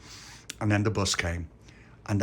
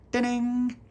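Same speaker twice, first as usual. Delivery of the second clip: bus bell ding. Acted.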